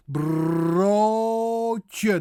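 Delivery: broodje with long trill and long oh; exaggerated Dutch broodje; rolled R demo. Shown as a demonstration. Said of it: "there's that rolling R".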